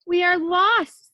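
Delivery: slow, loud and high wail of despair; despairing cry. A narrator's reading. Townspeople despair at the pasta flood, slow loud wail. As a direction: wail it like all hope is gone. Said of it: "said the people".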